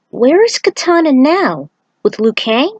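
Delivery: light character voice asking where Kitana went; questioning character voice. Acted. Character asks during story narration.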